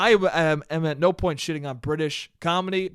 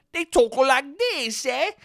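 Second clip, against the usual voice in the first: high, drawn-out caricature of British speech; mock British accent; 8 semitones higher than usual. Shown as a demonstration. Comparing two baking shows, exaggerated British accent. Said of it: "is the way they speak".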